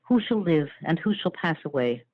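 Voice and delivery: ordinary talk, nothing marked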